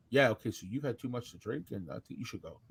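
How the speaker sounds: low, soft, measured reasoning; calm dismissal. Retold or imitated. Bouncer tells a drunk man to leave, low and calm. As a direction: talk him down very quietly.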